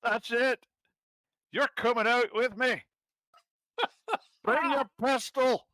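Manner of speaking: high put-on character voice; high, put-on voice for a teasing relative. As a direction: play the figure in a high voice.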